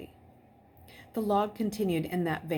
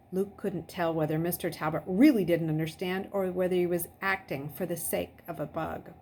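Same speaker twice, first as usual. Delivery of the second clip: ordinary delivery, nothing marked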